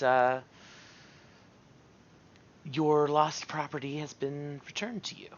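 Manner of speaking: a hint of a wary courtier; courtly elf character. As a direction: address a fey lord with careful respect. Plays Varys to Oberon.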